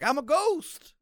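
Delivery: short high ghost cry; ghost call. Acted. Player dropping the carpet: high quick ghostly call. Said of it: "drop it like".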